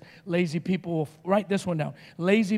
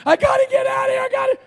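very high scream, acted out; panicked screaming; 4 dB louder, faster than usual. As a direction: shriek in mock panic to escape. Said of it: "just me and Paul screaming".

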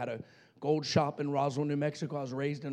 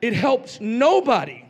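preaching at full force; 5 semitones higher, 9 dB louder than usual. Acted. Preacher pressing the lesson on his congregation, loud and emphatic. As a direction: drive the point home to the church.

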